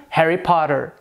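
"Harry Potter" with a flapped T and American R; American accent. Shown as a demonstration. Teacher comparing three accents, American accent.

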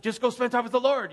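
fed-up brush-off; exasperated. Retold or imitated. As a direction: snap it off impatiently. Irritated pastor venting.

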